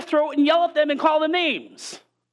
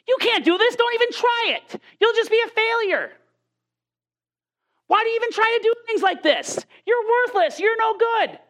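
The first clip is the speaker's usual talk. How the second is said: high, jeering accusation; devil's taunting voice; 8 semitones higher than usual. Retold or imitated. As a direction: taunt them like the enemy tearing you down. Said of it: "The enemy says".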